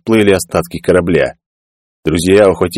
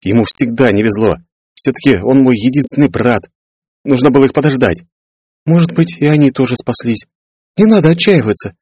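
higher-pitched, anxious character voice; worried survivors; 6 semitones higher than usual. Acted. Clarence and companion worry about lost brother, anxious. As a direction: fret over a missing brother.

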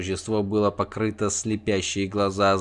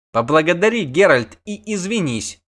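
sharp; 6 semitones higher, 4 dB louder than usual. A narrator's reading. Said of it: "резко сказала чародейка".

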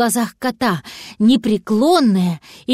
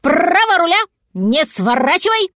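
raised, louder call across water; shouted commands; 7 semitones higher, 4 dB louder than usual. A narrator's reading. Dachshund coaching the swimming cat from shore, shouting. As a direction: shout steering orders from afar. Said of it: "кричал с берега".

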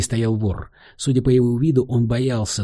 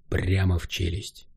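plain reading voice

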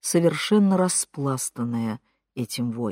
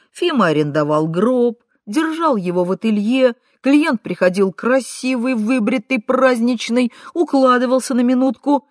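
higher, punchy, lively listing; cheerful argument; 6 semitones higher, 4 dB louder than usual. A narrator's reading. Katka arguing about photos in a coffin, cheerful. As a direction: reel it off merrily and insistently. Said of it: "весело возразила Катька".

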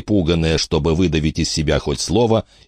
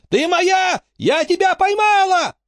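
very high-pitched possessive squeal; screamed claim; slower than usual. A narrator's reading. Frankie screaming at her captive, high claim. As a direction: shriek it high like a thwarted child. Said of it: "закричала Фрэнки".

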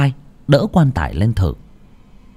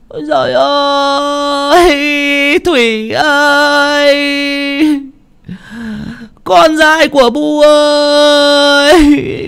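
high, long-drawn funeral keening; slow, sing-song lament at a very high pitch; sobbing wail; slower than usual. Acted. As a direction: wail for a dead child, stretching each cry. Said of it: "mới lại khóc nấc lên".

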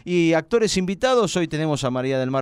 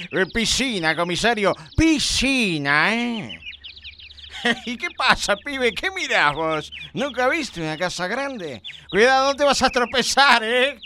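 snooty rich man; 7 semitones higher than usual. Acted. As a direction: correct him with snobbish disdain. Rich character corrects word.

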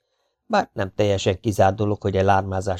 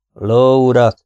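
louder, impatient interjection; kissé türelmetlen; 3 semitones higher, 7 dB louder, slower than usual. A narrator's reading. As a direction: cut in to get their attention. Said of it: "szólt közbe kissé türelmetlen hangon". (kissé türelmetlen közbeszólás)

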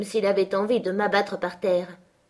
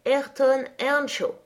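slow, syllable by syllable, higher; spelled out haltingly; 5 semitones higher, slower than usual. A narrator's reading. The unlettered cousin proudly spelling his name, slow and halting. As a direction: stumble through each syllable like a poor reader. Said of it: "Il épela en ânonnant, syllabe par syllabe".

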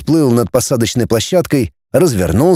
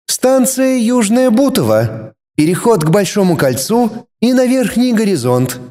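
station announcement; 9 semitones higher than usual. A narrator's reading.

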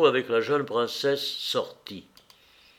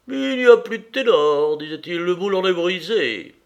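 much higher, louder man holding forth; pompous opera lover; 5 semitones higher, 6 dB louder than usual. Acted. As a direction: declaim it like a self-important opera buff. Stremov holds forth at opera.

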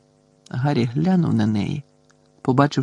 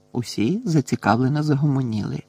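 ordinary delivery, nothing marked

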